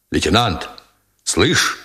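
ordinary delivery, nothing marked

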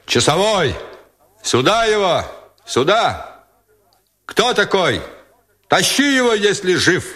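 high-pitched barked orders; roaring commander. A narrator's reading. Battalion commander shouting to sentry downstairs, strained roar. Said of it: "заревел бас комбата".

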